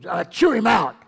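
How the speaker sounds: imitating a scolding reader, loud and harsh; hammed-up telling-off; mock angry scolding. Preacher mocking readers who scold, loud mock anger. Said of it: "most people read the word rebuke there and go".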